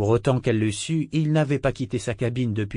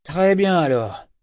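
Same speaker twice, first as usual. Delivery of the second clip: slow, louder and higher decision; sudden announcement; 6 semitones higher, 6 dB louder, slower than usual. A narrator's reading. Governor to Malta, suddenly deciding to go out. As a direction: blurt the decision out all at once. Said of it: "annonça-t-il subitement".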